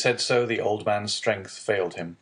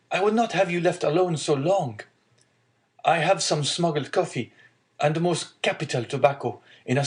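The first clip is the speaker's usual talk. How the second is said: higher, lightly voiced young man; caring young son; 7 semitones higher than usual. Son looking after lonely father, lightly voiced concern. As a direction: urge him kindly, pitch lifted. Dante cares for his father.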